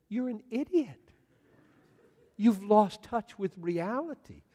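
high, loud mock disbelief; incredulous scolding. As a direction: tell off a fool in amazement. Onlookers scold the blubbering man.

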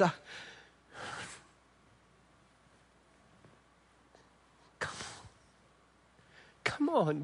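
soft, tender father's plea; softly, like a father aching for his son. Shown as a demonstration. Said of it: "it helps to say something like".

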